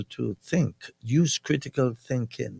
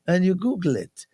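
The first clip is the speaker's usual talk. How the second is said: ordinary delivery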